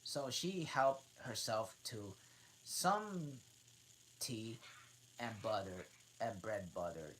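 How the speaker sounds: plain reading voice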